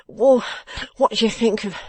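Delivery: loud, high, stammering pained question; suffering. A narrator's reading. Beaten man asking about a remedy, suffering. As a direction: stumble into the question through pain. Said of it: "inquired the suffering Fledgeby".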